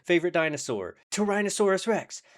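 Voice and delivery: high-pitched, eager quick-fire exchange; Step Brothers joke. Retold or imitated. Acting out two doubles doing the Step Brothers joke, excited. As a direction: blurt question and answer in a high, excited voice. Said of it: "the Step Brothers joke".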